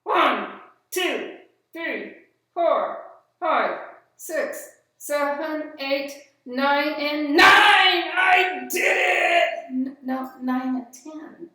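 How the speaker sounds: proud puppet. Acted. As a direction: count proudly and get it wrong. Puppet counts loudly, muddles.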